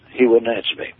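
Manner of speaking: ordinary talk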